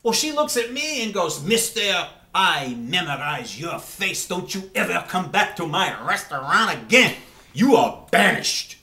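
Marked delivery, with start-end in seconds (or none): 1.4-8.8 put-on slow, louder waitress in a wobbly French accent; bad French accent. Waitress banishing Jim from the restaurant, bad French accent. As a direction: scold him in a shaky fake French accent. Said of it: "That sounded like Indian to me".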